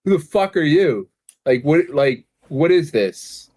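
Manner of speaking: high, loud confused outburst; bewildered. Retold or imitated. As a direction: sound totally thrown. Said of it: "I'm like".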